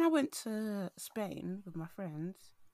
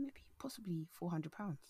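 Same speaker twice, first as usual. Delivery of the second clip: hushed whispered confession; whispering; 5 dB quieter than usual. Real, not acted. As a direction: whisper the figure. Admitting the amount scammed.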